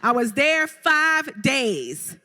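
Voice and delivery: FIVE DAYS high and very drawn out; slow, high emphasis on "five days"; emphatic punchline. Real, not acted. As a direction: land "five days" slowly, word by word. Punchline after guess how many days.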